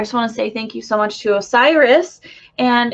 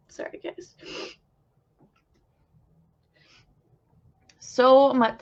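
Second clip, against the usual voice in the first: slow, halting, teary, with sighs between the words; overwhelmed near tears; 3 semitones higher, slower than usual. Real, not acted. Medium to viewers, overwhelmed while channeling, near tears. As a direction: fight back tears, letting long pauses fall. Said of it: "I don't know why I'm gonna cry".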